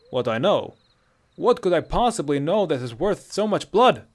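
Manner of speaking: loud, incredulous Nord; husky Nordic protest; husky Nordic captive. A narrator's reading. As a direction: challenge them, voice rising. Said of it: "This voice was husky and distinctly Nordic".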